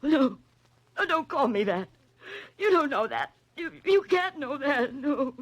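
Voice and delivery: high, shaken denial, voice climbing as she's found out; backing away from the truth in panic; frightened denial. Acted. Woman exposed by detective denies her name, frightened.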